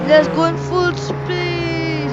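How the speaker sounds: loud and drawn out, slowly; slow excited call. Real, not acted. Gamer to viewers while speeding, loud and slow. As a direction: hype it up slowly.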